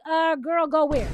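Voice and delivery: loud, disbelieving clapback; sassy, loud disbelief; incredulous reaction. Retold or imitated.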